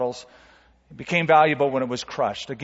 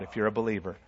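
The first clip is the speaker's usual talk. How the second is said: ordinary delivery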